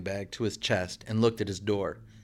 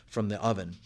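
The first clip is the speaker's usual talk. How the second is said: ordinary delivery, nothing marked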